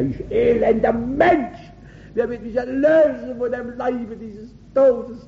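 acted anguished outcry; despairing cry. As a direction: wail it like a wretched sinner pleading for rescue. Acts out Paul's cry of despair.

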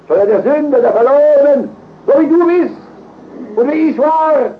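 high, loud, ringing proclamation; full-force preaching. Real, not acted. Preacher proclaims a saviour for the lost, at full force. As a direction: cry it out to the back rows.

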